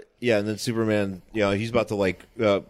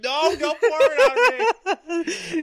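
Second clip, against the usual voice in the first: high squeaky laughing plea; talking vegetable; 5 dB louder, much slower than usual. Pretend. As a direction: squeal it out slow through laughter. Superman as plant pleading, laughing.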